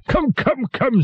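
high-pitched impatient urging; impatient king. A narrator's reading. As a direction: hurry the jester along testily. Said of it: "said the king impatiently".

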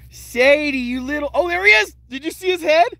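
high, loud, thrilled; excited. Real, not acted. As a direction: call it out as it appears. Mole pops out.

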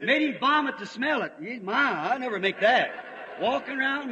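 normal talking voice

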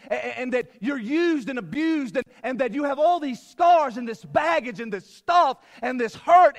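high, loud, driving sermon delivery; urgent, pounding pulpit exhortation; impassioned preaching. Real, not acted. Preaches at full force.

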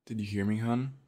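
loud call to someone in another room. Acted. Partner calling out on arriving home, loud call. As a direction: call out cheerfully as you come through the door.

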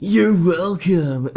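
game character, male. Acted. Vet replying to the thanks, slow put-on voice. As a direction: draw it out as a goofy character. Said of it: "No, you do him".

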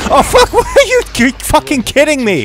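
very high, loud shriek at the jump scare; real startled scream. Real, not acted. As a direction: scream then laugh in disbelief.

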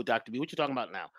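mock listener aside. Imagined listener objecting.

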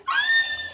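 high shriek at the cold; shocked yelp from icy water. Real, not acted.

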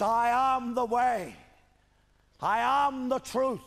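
loud, high, stretched-out declaration; proclaimed scripture. Retold or imitated. Preacher quoting what he says of himself, loud proclamation. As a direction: proclaim "I am the way" to the whole hall.

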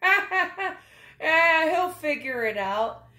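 mocking uncle, loud and amused; laughing obnoxious uncle. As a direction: laugh it off like a beer-holding uncle. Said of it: "laughing at you going".

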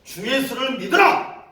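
booming, high, slowed proclamation; very loud voice. Shown as a demonstration. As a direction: show off a huge street-preaching voice. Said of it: "웬만한 사람보다 목소리 커요".

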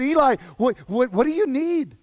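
high, loud, stammering question; flustered urgency. Acted. Boy Samuel runs to Eli on waking, flustered and urgent. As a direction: stumble over the words in mild alarm.

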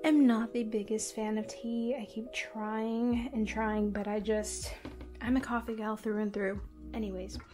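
normal talking voice

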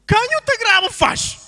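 very high, loud yell; sudden comic high shout; shouted reply from above. Acted. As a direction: holler it out at the top of the voice. Voice from above booms back.

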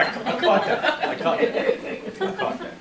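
high, laughing repeats, slowing down. Real, not acted. Caught in a verbal slip by the host, laughing. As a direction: laugh through it as the slip lands. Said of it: "We all know what you meant".

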